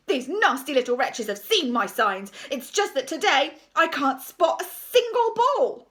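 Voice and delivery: loud, indignant complaint; thundering rant. A narrator's reading. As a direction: rail loudly, rising and slowing towards the end. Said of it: "he thundered".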